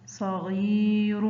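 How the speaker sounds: صغير slowly, غي held long; drawn-out example word. Shown as a demonstration. Teacher showing pupils the long vowel, slow and stretched.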